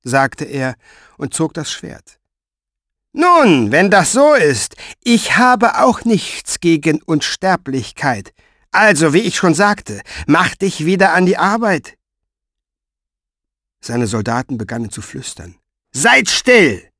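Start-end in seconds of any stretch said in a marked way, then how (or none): 3.1-12.0 high, loud, cold menace; menacing Pfeifer. A narrator's reading. Pfeifer repeating his order to Mo, high and menacing. 15.9-16.9 high, loud sharp rebuke; snapping at soldiers. A narrator's reading. Pfeifer silencing his whispering soldiers, loud snap. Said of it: "fuhr der Pfeifer sie an".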